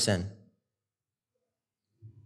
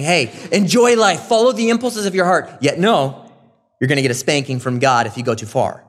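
higher and louder, playful scolding voice; mock warning parent; 4 semitones higher, 5 dB louder than usual. Pastor mocking a misreading of Solomon, playfully scolding and loud. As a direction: ham up the wagging-finger warning. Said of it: "That's how it sounds".

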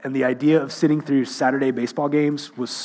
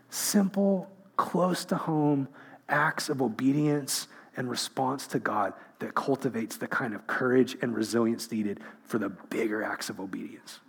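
hushed preaching; 3 semitones lower, 8 dB quieter than usual. Real, not acted. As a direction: lean in and drop the voice to draw them close. Preacher drops to intense quiet.

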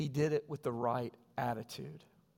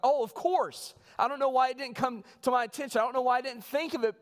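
much higher and louder, eagerly agreeing; Mary's imagined reply; 9 semitones higher, 6 dB louder than usual. Retold or imitated. Mary answering boy Jesus, high eager agreement. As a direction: gush it like an over-eager mother caught out.

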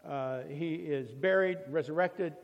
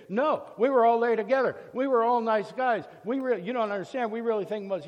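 indignant protest; 5 semitones higher, 6 dB louder than usual. Acted. Crowd protests against Stephen.

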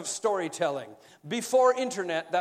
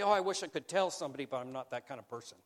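mock reluctance; 7 semitones lower, 5 dB quieter, much faster than usual. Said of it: "You are probably not gonna say".

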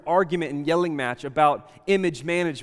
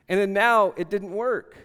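ordinary delivery

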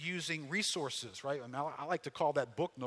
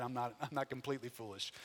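normal talking voice

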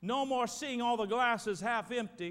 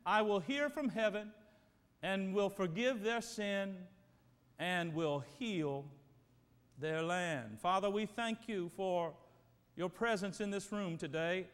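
hushed, reverent prayer voice fading low; 4 semitones lower than usual. Acted. Pastor praying over the congregation, hushed and reverent. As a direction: pray it over the room, softening as you go.

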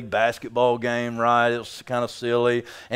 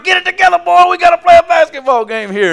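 much higher, louder scolding yell; retold yell at son; 10 dB louder than usual. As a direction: bark it like an upset dad courtside. Yelling at son during game.

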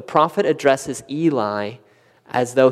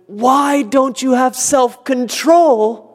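high, slow, exasperated parent scolding; mock scolding parent; 8 semitones higher, much slower than usual. A parent scolding the children, mock exasperated and slow. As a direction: draw it out like a fed-up parent.

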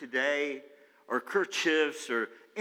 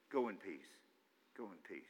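much softer, tender release; gentle blessing; 8 semitones lower, 14 dB quieter than usual. Acted. Elisha sending Naaman off without guilt, soft and tender. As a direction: let him go with kindness.

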